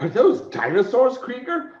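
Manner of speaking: high, incredulous stage question; acted astonishment. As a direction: ask it like a startled adventurer. Acted stage line in show.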